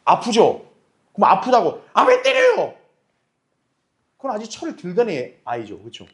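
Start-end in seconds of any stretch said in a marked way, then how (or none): 1.9-2.7 put-on very high, whining child's protest; whiny child. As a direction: complain like a smacked kid. 아이가 맞고 항의함. (아이처럼 아주 높게 칭얼대는 목소리)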